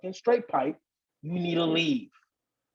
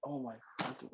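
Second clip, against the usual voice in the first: much softer, near hushed; alarmed hush at the window; hushed panic; 5 semitones lower, 16 dB quieter than usual. Retold or imitated. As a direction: hiss it so he can't hear. She sees him from window.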